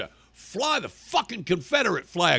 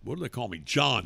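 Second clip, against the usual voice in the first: usual speaking voice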